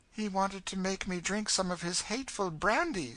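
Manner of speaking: put-on high, upset girl's voice; distressed young girl. Girl confiding in companion about the brandy, distressed.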